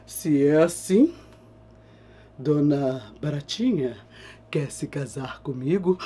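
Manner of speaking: a hint of a grave king; lightly voiced king. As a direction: ask gently like a courtly king. King proposes.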